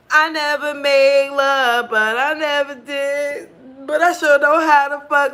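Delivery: mock proud confession; sassy boast. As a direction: lay it on with swagger. Her sassy retold reply.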